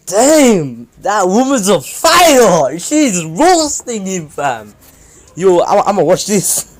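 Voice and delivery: loud, high, hyped-up shouting at a scene; hollering with delight at someone getting roasted; excited yell. Real, not acted. Watching a woman roast a cheating man, hyped shout. Reacting loudly to nearby argument.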